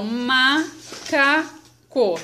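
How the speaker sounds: "macaco" slowly in three separate syllables; "macaco" in three drawn-out beats; split into syllables. Shown as a demonstration. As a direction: break "macaco" up with a pause after each syllable. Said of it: "Essa palavrinha tem três sílabas".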